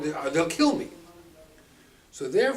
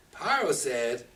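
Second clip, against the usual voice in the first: ordinary talk, nothing marked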